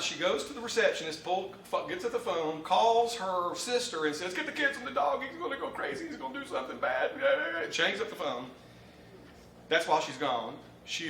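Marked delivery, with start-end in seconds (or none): 4.3-7.1 urgent warning. Retold or imitated. Wife warns sister by phone.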